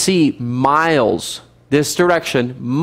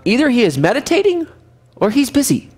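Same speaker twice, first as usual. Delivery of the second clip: higher, teasing sarcasm; mocking excuses; 9 semitones higher, faster than usual. Elijah teasing the priests about their sleeping god. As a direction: list silly excuses for the absent god. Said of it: "Elijah mocked them".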